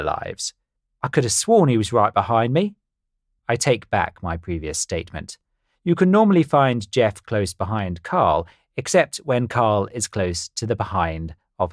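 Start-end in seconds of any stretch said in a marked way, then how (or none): none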